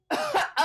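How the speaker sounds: breathy high-pitched giggle; a quick girlish giggle. Acted.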